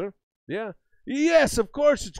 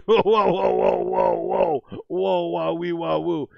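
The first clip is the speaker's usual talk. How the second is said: louder hammed-up whoa whoa; mock alarm; 6 dB louder, slower than usual. Streamer playing the custodian stopping foul language, hammed-up louder whoas. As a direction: pretend to be shocked and wave it down.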